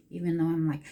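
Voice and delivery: usual speaking voice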